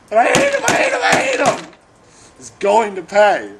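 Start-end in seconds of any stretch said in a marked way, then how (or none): 0.1-1.7 angry shout. Acted. Jilted rage outburst.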